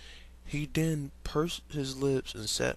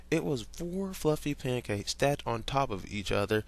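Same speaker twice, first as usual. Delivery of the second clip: ordinary delivery, nothing marked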